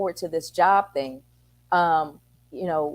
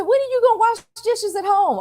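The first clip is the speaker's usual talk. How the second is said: high, louder disbelieving question; amazed mom; 4 dB louder than usual. Retold or imitated. Mom questioning her son about dishes, high and amazed. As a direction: pitch it way up in mock astonishment.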